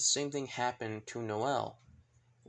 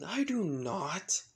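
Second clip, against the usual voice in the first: high, drawn-out protest; indignant denial; 6 semitones higher, much slower than usual. Acted. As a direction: deny it slowly and indignantly. Acted denial of having a girlfriend.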